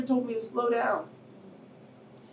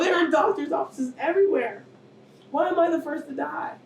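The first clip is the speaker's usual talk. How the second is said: ordinary talk, nothing marked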